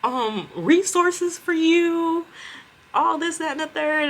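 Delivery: high falsetto grievance, hammed up; mock outrage. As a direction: imitate the supervisor scolding. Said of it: "she was like".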